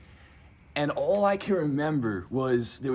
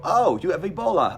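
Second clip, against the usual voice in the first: higher, louder mock-alarmed announcement; 6 semitones higher, 7 dB louder than usual. ER staff to the patient, mock alarm. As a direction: imitate staff blurting out a diagnosis.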